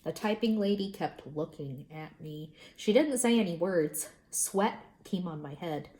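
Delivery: ordinary delivery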